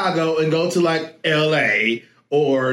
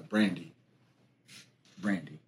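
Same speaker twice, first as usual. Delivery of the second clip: soft hushed name; 9 semitones lower, 12 dB quieter than usual. Shown as a demonstration. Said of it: "Can we do that again?".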